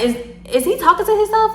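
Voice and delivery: high, loud incredulous question; shocked. Retold or imitated. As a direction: ask it in total disbelief.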